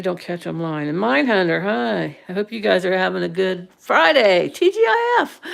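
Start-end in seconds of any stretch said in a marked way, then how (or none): 4.5-5.4 high, bright TGIF cheer; greeting viewers with a high TGIF cheer; excited cheer. Real, not acted. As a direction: sing out the letters happily.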